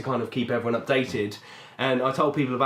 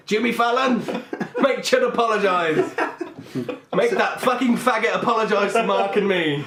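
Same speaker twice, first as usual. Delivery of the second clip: raised-pitch indignant demand; mock outrage; 5 semitones higher than usual. Offended man demands an apology through Jimmy Fallon, mock outraged. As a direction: demand justice in hammed-up outrage.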